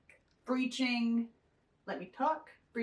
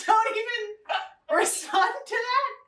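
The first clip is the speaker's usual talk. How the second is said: laughing slowed; 6 semitones higher than usual. Real, not acted.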